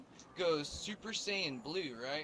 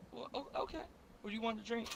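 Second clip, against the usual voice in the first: higher, startled cashier; 8 semitones higher, 4 dB quieter, much faster than usual. Retold or imitated. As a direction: fluster like a surprised cashier. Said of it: "she was like".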